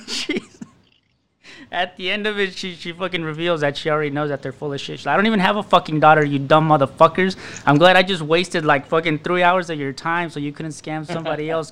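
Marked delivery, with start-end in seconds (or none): 5.1-11.7 loud, emphatic retold rant; gloating telling-off. Girl revealing her trick to scammers, gloating tirade. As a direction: let them have it with relish.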